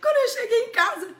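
high, loud laughter breaking the words; laughing hard. Real, not acted. Wife tells guests the empty house, laughing hard. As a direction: laugh through the punchline, almost crying. Telling the punchline, laughs heard.

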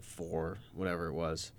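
ordinary talk

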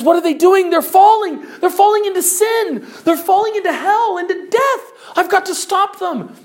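high, loud, horrified outcry; frantic, high-pitched dismay; acted alarm. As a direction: cry out in rising panic to save them. Watching people fall into hell.

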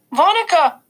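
put-on a toddler tugging for attention; small child calling. A narrator's reading. Little brother calls to the babysitter, high child voice.

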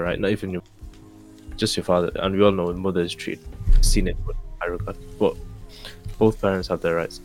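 ordinary talk, nothing marked

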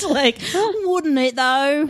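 high, slow Cockney "wouldn't it"; Cockney accent. Pretend. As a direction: drawl it out in broad Cockney. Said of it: "most Cockney accent".

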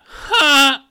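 high, loud baffled huh, no; incredulous huh. Retold or imitated. Retelling rejecting coworker's friendship, incredulous high no. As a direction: act out total confusion. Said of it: "I looked at him, I was like".

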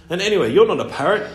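fast, high protest; indignant boy. Acted. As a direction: blurt it out defiantly. Boy argues with bird.

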